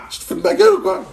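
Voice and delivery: outraged shout pitched high; furious at the locksmith asking six hundred euros; angry yell. Retold or imitated. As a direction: yell it in disbelief at the rip-off. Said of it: "Alors, je commence à gueuler".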